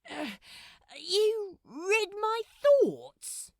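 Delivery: high, loud, slow disbelief; stunned he read your mind; astonished. A narrator's reading. Crowley realizing Gabriel heard his thoughts, high and stunned.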